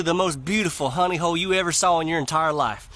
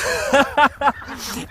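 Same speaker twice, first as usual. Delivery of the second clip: real burst of laughter, higher; hearty laugh; 8 semitones higher than usual. Real, not acted. Teller laughs at own joke.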